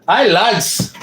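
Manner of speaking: high, put-on Scottish accent. Shown as a demonstration. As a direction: greet them in a hammed-up Scottish accent. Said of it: "my Scottish accent".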